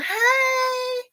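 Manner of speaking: put-on very high, drawn-out child's hello; little kid greeting. Little kid coming up to say hey, mimicked piping voice. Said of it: "Second little kid comes up".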